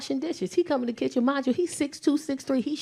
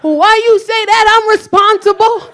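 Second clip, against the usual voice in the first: put-on indignant drunk mom; 10 semitones higher, 13 dB louder, slower than usual. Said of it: "He said".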